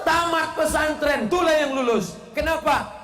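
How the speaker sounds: high, loud preaching punch; emphatic shout. Acted. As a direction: hit the punchline hard. (seruan keras penuh semangat)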